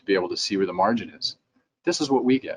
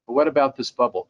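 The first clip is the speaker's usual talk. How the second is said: ordinary talk, nothing marked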